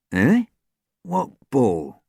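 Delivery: drowsy, puzzled query; sleepy question. A narrator's reading. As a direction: ask as if half awake. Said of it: "Dan questioned sleepily".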